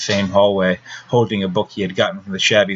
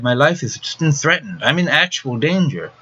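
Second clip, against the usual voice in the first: put-on higher, alarmed voice of a frightened girl; higher pitched frightened plea to the nurse; frightened outcry; 6 semitones higher than usual. A narrator's reading. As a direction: cry it out in panic, lifting the pitch. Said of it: "Beverly cried".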